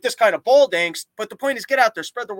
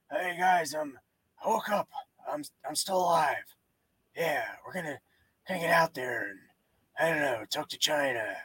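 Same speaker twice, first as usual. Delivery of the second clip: put-on low, soft, drowsy old-president voice, much lower and softer; Biden impression; 5 semitones lower, 7 dB quieter than usual. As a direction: mumble it like a barely awake old president. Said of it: "Biden should do... get up there and go".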